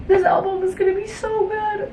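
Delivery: excited squeal. Real, not acted. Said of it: "repeated line".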